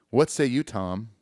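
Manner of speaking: normal talking voice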